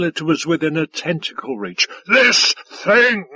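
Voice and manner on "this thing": loud, high, emphatic roar; booming alien bully. A narrator's reading. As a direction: roar it out with disdain. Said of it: "roared Five-Tides".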